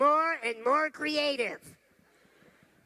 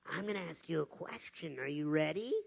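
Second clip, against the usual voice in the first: ordinary talk, nothing marked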